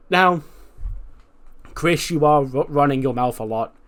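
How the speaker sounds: hammed-up scolding mentor; stern Regal. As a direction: wag a finger at him with your voice.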